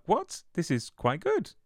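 mock surprise. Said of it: "and I was like".

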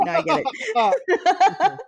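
high, slow, through laughter; laughing. Real, not acted. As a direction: say it while cracking up. Cracking up at the joke.

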